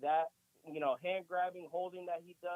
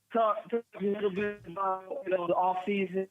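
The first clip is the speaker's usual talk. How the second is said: bluetooth headset mic; 8 dB louder than usual. Real, not acted. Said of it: "Bro, you there?".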